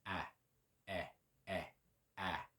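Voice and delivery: ah sliding to eh and back. Shown as a demonstration. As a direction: move from ah to eh and back. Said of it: "The way I learned it was E H".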